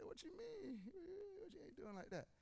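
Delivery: incredulous pushback at the instructor; retold disbelief. To the old swim instructor, baffled high-pitched protest. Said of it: "I said".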